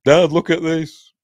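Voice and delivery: high, loud imitation of an excited son; Aidan. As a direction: imitate a kid calling Dad over, drawn out. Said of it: "that made Aidan laugh".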